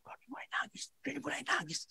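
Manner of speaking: hushed unvoiced aside about someone; whispering. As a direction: whisper it like gossip behind a hand. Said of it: "eh, dia bisik-bisik".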